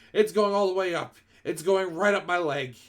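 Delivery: high, loud panicked yell. A narrator's reading. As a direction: yell in alarm as it climbs. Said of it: "the man was yelling".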